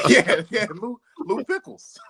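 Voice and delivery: high, slow, laughing over the name. Real, not acted. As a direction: savour the name, still laughing. Laughing at pickle company name.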